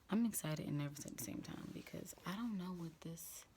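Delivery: hushed unvoiced whisper to the camera. Real, not acted. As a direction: whisper so nobody in the house hears. Whispering indoors.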